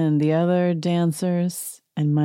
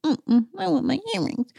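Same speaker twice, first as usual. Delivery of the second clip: much higher, whiny mock voice; mean Erica impression; 11 semitones higher than usual. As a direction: pout it out meanly. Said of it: "she's got a little mean face".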